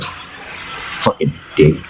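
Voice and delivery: slow scoff under the breath. Acted. Brother scoffs at his younger sibling, muttered contempt. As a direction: scoff it out low and softly. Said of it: "He kinda scoffs".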